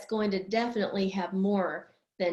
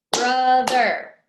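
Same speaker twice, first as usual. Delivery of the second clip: BROTHER in two loud, even beats; syllable by syllable; 8 dB louder than usual. Shown as a demonstration. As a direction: punch out BRO and THER in BROTHER. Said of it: "How many syllables?".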